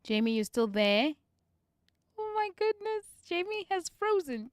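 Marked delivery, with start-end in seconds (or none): none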